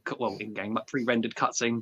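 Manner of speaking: normal talking voice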